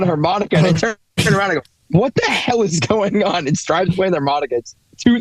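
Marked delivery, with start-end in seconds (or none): 2.0-3.5 drawn-out, high-pitched exclamation of disbelief; retold outburst, stretching out each word; mock bewilderment. Friend hears a harmonica in the night, bewildered.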